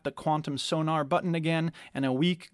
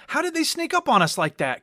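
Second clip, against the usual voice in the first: much higher, louder; alarmed question; 12 semitones higher, 6 dB louder than usual. A narrator's reading. Kopesh as the ship is hit, alarmed. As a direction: shaken by the hit.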